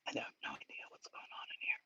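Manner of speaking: fast unvoiced whisper under his breath; whispered aside. Real, not acted. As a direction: mutter it to yourself in a whisper, baffled. Baffled aside to himself.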